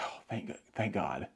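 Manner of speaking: breathy whispered thanks of relief; relieved whisper. Retold or imitated. Muttering to himself after escaping notice, relieved whisper. Relieved aside, unvoiced then very quiet.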